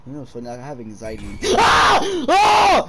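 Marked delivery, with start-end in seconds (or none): 1.6-2.9 high loud shriek of fright; startled scream. Real, not acted. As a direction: yelp at a sudden scare. Jump scare.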